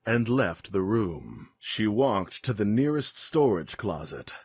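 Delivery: plain reading voice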